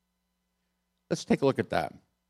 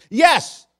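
a much higher, louder snapped yes; sharp retort; 7 dB louder than usual. Retold or imitated. Jonah retorting to God, high and loud. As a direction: bite back at God in one word. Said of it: "Jonah retorted".

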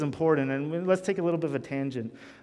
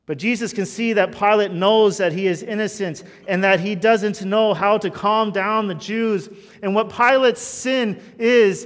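loud preaching, driving each phrase home; full-force preaching; 6 semitones higher, 9 dB louder than usual. Acted. Preacher urging his congregation, loud and full-voiced. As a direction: preach it to the whole room.

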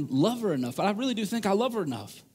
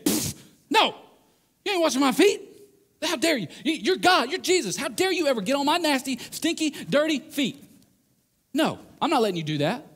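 indignant refusal; 6 semitones higher than usual. Retold or imitated. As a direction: refuse him, scandalized. Peter refusing Jesus washing feet.

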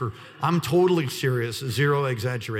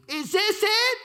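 put-on kid's question; 4 dB louder, slower than usual. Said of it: "He says".

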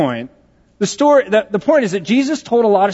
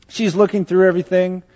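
ordinary delivery

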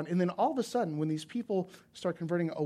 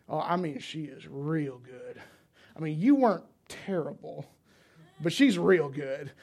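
Southern Indiana drawl; 4 dB louder, slower than usual. Retold or imitated. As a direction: stretch it out like a southern Indiana barman. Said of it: "there's a little bit of a drawl".

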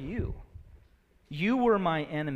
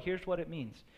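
usual speaking voice

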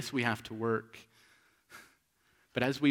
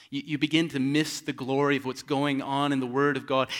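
normal talking voice

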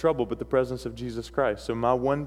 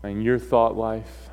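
solemn sermon closing; 5 semitones lower, 6 dB quieter than usual. Real, not acted. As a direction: bring the message home softly, with long pauses. Preacher's solemn closing exhortation.

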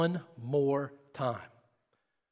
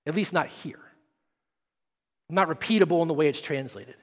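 ordinary delivery

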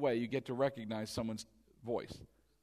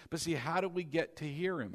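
normal talking voice

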